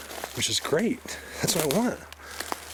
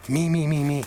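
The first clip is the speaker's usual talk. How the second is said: mocking greedy; 5 semitones higher, 5 dB louder than usual. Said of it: "they all want it to be for them".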